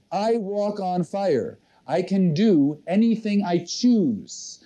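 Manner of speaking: slow, deliberate affirmation; measured, drawn-out words to write on a card; slow dictation. Shown as a demonstration. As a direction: dictate it unhurriedly for copying down. Repeats dictation for audience to write.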